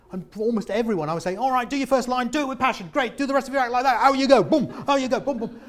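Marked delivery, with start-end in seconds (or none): 1.4-5.6 higher, louder, brisk pep-talk patter; rapid-fire conveyor belt. Retold or imitated. Coach sending comics onstage one after another, rapid-fire pep talk. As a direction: churn them out like a conveyor belt, boom boom. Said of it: "it became like a conveyor belt".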